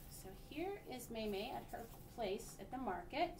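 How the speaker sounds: ordinary delivery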